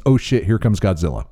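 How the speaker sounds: normal talking voice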